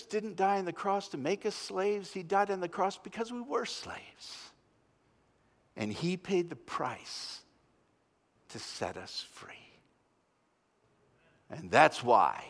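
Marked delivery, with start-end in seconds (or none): 5.8-9.8 slow, fading to a whisper; hushed climax. Real, not acted. Preacher bringing the Good Friday message to a whispered close. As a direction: let it fall to a breath. Sermon climax dropping to hush.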